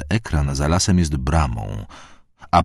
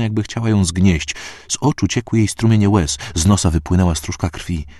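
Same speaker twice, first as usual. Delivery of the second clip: usual speaking voice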